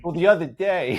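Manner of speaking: hammed-up Long Islander starting a story; Long Island accent. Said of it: "if you think we talk that way".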